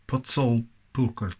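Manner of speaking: "Potsolpurkult" sounded out slowly and carefully; a slow, tentative go at saying "Potsolpurkult"; attempted Hungarian pronunciation. Shown as a demonstration. Presenter tries the Hungarian name of the tinned stew, careful pronunciation of "Potsolpurkult". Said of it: "I think it's pronounced".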